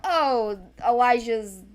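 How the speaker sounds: ordinary delivery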